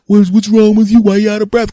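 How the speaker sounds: high, loud questioning voice; puzzled relative. Acted. Found relative asking the tired searcher, puzzled and high. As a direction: pitch it way up as the clueless relative.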